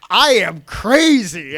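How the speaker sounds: slow, loud and high, every word drawn out; a hammed-up unhinged heel's boast; crazy wrestler declaration. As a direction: proclaim your madness to the crowd. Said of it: "looks at the crowd and goes".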